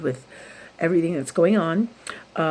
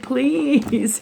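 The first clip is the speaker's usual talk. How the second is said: high, drawn-out begging please; mock pleading; 8 semitones higher, 4 dB louder than usual. Begging God for a break, high and drawn out. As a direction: beg the heavens, half joking.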